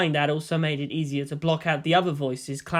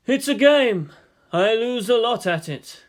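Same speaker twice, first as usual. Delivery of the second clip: higher, louder, shrugging voice; Yang's voice; 6 semitones higher, 4 dB louder than usual. Acted. Yang shrugging off losing at chess, higher and offhand. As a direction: brush it off lightly.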